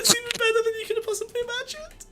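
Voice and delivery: high, loud excited yelling; excited shout. Real, not acted. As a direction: shout it out with glee. Excited about the video.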